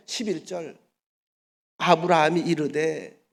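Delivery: ordinary talk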